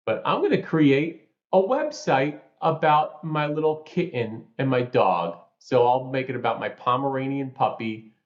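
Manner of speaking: high New York auntie voice; New York accent. Retold or imitated. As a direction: play a chatty New York aunt with a New York accent. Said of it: "I'll put on my New York accent".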